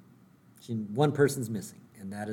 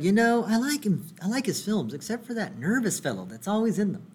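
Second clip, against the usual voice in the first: Ned Flanders voice; 8 semitones higher than usual. Retold or imitated.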